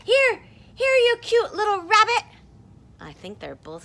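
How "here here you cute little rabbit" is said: high, loud shouted call. Acted. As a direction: shout it as if the words were in big bold letters. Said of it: "in big capital bold letters".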